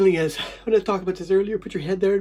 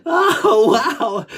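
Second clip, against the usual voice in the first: elated gasp of relief between laughs; amazed exclamation; 2 semitones higher, 5 dB louder than usual. Real, not acted. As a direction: burst out in giddy wonder. Exclaiming amid laughing fit.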